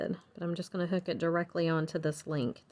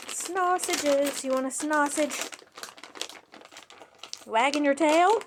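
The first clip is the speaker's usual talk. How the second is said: excited dog talk; 7 semitones higher than usual. Real, not acted. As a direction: get the dog all worked up. Talking to her dog Sadie.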